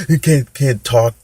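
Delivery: high, loud mock sob catching on each word; hammed-up hiccuping cry; imitated sobbing stammer. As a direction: act too choked with crying to talk. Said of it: "he's in that cry".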